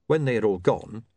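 usual speaking voice

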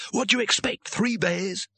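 high-pitched scornful retort; snarled. A narrator's reading. Leader scoffing at the youngest wizard, snarled. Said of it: "snarled the leader".

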